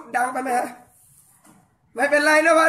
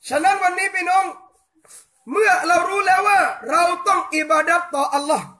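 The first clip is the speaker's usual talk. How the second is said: fervent shouted sermon, hammering each question; preaching at full force; 7 semitones higher, 4 dB louder than usual. Acted. Preacher rousing the island congregation, fervent shouting sermon.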